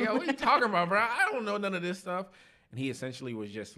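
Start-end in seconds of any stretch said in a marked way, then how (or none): 0.0-2.3 high, loud, comic disbelief; mock-exasperated high-pitched retort; baffled protest. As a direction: protest loudly that you're totally lost. Retold baffled reply about Greek.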